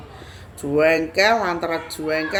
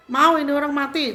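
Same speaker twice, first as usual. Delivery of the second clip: high retold scolding question; bentakan; 9 semitones higher, 4 dB louder than usual. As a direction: warn the onlooker sharply, pitched up. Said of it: "Saya bentak aja tuh orang". (membentak memperingatkan, suara tinggi)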